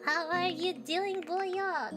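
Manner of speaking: cute anime streamer. Acted. Greeting viewer cutely.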